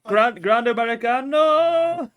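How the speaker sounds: long drawn-out, high barista call; mock shouting out. Barista shouting out a coffee order, drawn-out holler. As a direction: holler it across the shop, stretching it out. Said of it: "shouting that out".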